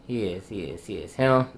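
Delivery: ordinary delivery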